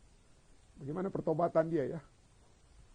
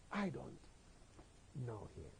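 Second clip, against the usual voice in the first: low, very soft, halting words; hushed denial; 5 semitones lower, 18 dB quieter than usual. Acted. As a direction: let the denial sink away almost to nothing. Last denial, collapsing to quiet. (lirih, rendah, terputus-putus)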